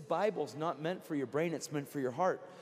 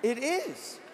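high, loud pulpit proclamation; fervent revival preacher's cry; full-force preaching; 9 semitones higher, 7 dB louder than usual. Acted. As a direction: shout it to the congregation. Preaching surges again.

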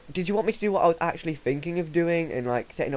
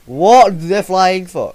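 loud, slow, stunned yell; shocked shout; 4 semitones higher, 11 dB louder, much slower than usual. Real, not acted. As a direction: yell it out in disbelief. Shocked by sudden water.

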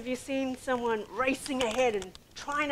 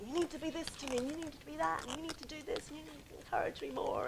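higher, softer rattled-off list of demands; demanding nag; 6 semitones higher, 5 dB quieter, faster than usual. Retold or imitated. Wife listing what her husband must be, mock nagging. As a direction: imitate a needy nag, pitched up. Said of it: "I had this expectation of Chris".

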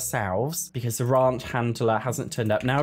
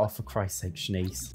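much softer and lower, under the breath; exasperated mutter; 7 semitones lower, 8 dB quieter than usual. Real, not acted. Player fed up with panicking Shanice, quiet low mutter. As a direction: sigh it out to yourself.

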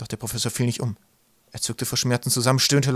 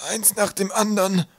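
put-on high, breathless old voice; gasping, exhausted scholar; panting professor; 10 semitones higher than usual. A narrator's reading. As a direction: wheeze it out high. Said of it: "keuchte der Professor".

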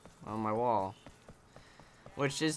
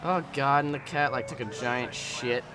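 normal talking voice

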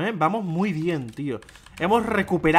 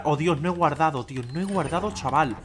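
ordinary talk

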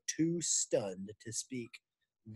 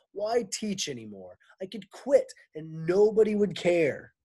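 raised, loud complaint; complaining teacher; 7 semitones higher, 6 dB louder than usual. Acted. Teacher griping to men, loud complaint. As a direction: Miss Zarves whining for respect.

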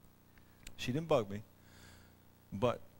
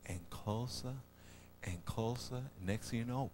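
ordinary talk, nothing marked

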